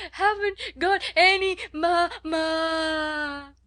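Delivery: put-on high, loud child's wail, last syllable held; tempestuous howl. A narrator's reading. Spoiled child crying for her mother, howling. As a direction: bawl it out like a tantruming child. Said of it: "Lottie howled tempestuously".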